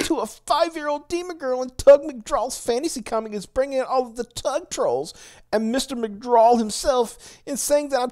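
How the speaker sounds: squeaky crazy cat dad impression; Mark Lombardi voice. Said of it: "should I do a Mark Lombardi, uh, crazy, uh, cat dad voice".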